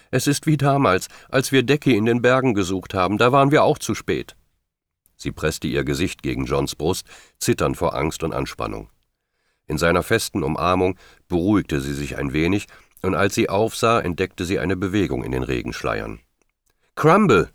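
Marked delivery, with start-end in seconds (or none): 0.1-4.4 high, distressed voice, lightly sobbed; anguished and higher-pitched, a touch tearful; tearful reply. A narrator's reading. Woman to John, remembering the search for Decky, tearfully distressed. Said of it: "bei ihrer schluchzenden Erwiderung". 17.0-17.5 high, loud call of a name. A narrator's reading. Julie spotting the pony from the side, calling out. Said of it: "rief Julie von der Seite".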